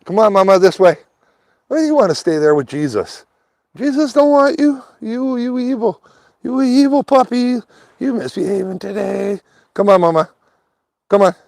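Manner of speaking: high-pitched singsong scolding of the puppy; high pet talk to dog. Real, not acted. Man coaxing his dawdling dog on a walk, high pet voice.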